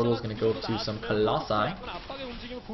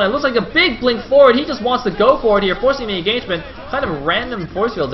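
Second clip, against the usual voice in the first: raised pitch, loud, urgent commentary; real excited caster's shout; excited play-by-play; 9 semitones higher, 8 dB louder than usual. Real, not acted. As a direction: build excitement as the fight starts. Live call of an attack.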